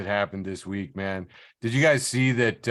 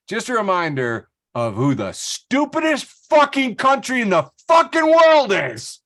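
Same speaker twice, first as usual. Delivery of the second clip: exasperated rant; 9 semitones higher, 5 dB louder than usual. Real, not acted. Ranting about US debt limit.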